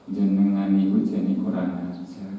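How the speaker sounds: soft, low-key telling-off; quiet scolding. Shown as a demonstration. Preacher showing how to vent anger without shouting, quiet scolding. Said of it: "ngomongnya nggak boleh banter-banter".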